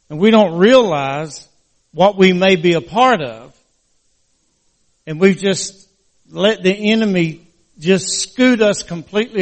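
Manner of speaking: preaching. Acted. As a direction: lift it into a preaching plea. Pastor rising into preaching.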